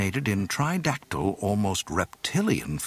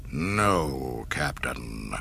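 snarl; 7 semitones lower, much slower than usual. A narrator's reading. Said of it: "he snarled".

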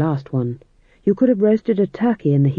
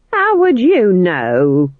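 sharp, slow, louder snap; snapping; 3 semitones higher, 6 dB louder, much slower than usual. Acted. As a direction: bite the words off at her. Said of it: "snapped Nanny".

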